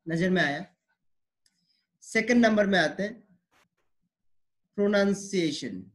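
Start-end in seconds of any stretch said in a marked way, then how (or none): none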